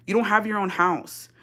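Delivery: ordinary talk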